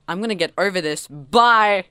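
quick line into a loud "bye"; mock brisk dismissal. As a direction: brush it off as the star, then belt the farewell. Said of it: "then she's just like".

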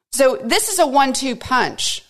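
normal talking voice

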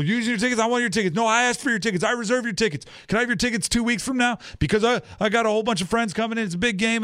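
high, eager begging teammate voice; mock needy locker-room teammate; pushy teammates. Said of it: "people are".

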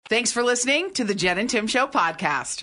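slow, loud sign-off read; podcast outro. Acted. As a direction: announce the show outro.

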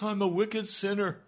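high, loud pleading prayer; desperate confession. Shown as a demonstration. Preacher modelling the confession the congregation should cry.

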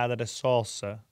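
put-on lightly held Italian accent. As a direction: finish the line in an Italian accent. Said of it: "Just like doing the Italian accent?".

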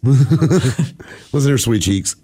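slow, drawn-out sleazy come-on; hammed-up tough-guy flirt; gangster's moll. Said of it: "And you'd be like".

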